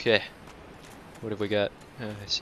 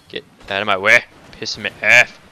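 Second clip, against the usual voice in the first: loud, real angry shout; irritated shouting; 9 dB louder than usual. Real, not acted. Gamer blocked in a narrow hallway, loud irritated shout. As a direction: snap at the enemy blocking the way. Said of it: "So irritated".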